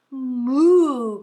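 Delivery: loud drawn-out moo; loud cow cry. Acted. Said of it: "cried the cow".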